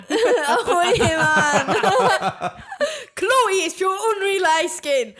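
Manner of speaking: laughing Geordie accent impression. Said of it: "working on my Geordie accent".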